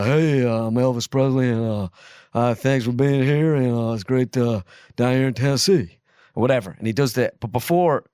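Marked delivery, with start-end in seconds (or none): 0.0-6.0 drawling Southern US stage patter. Acted. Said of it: "in the movie it's like".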